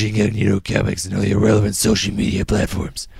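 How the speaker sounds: steady, measured announcer-style ad read; podcast host reading sponsor copy. Acted. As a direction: read the copy evenly, selling to listeners. Reads the sponsor ad.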